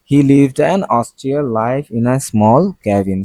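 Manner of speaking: very slow, careful English read; slow example read. Shown as a demonstration. Teacher reading an example sentence to students, very slow. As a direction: spell out the sentence slowly for students.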